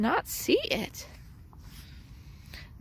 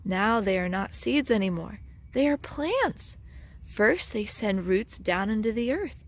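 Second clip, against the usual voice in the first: ordinary delivery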